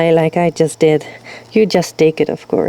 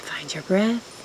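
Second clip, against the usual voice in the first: whispering; 11 dB quieter, slower than usual. Real, not acted. Teacher cues breath softly.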